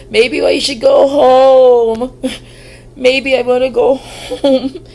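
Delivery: loud, drawn-out panicky whine; panicked wail. Retold or imitated. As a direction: wail it like you're about to bolt. Retold panic, calling partner.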